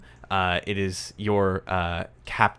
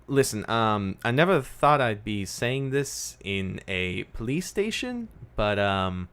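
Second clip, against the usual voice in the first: lightly voiced, halting and higher at first; awkward young woman; 5 semitones higher, 4 dB louder than usual. Young woman questions detective's odd name, awkward. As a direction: stumble curiously, then ask for help.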